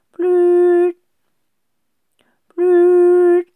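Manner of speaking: high, loud phone tone; phone ringing tone. A narrator's reading. Dial tone from the phone on speaker, beeping. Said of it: "tutet es aus dem Handy".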